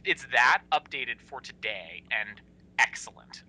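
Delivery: hushed whisper, spelling out the idea. Real, not acted. Host whispers the pitch to the co-host, like a secret.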